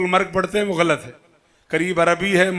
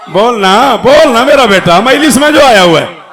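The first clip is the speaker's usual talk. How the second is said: loud coaxing call to the congregation; calling out to crowd; 4 semitones higher, 9 dB louder than usual. Real, not acted. Preacher loudly urging the majlis crowd to call out. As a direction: rouse the crowd to answer back.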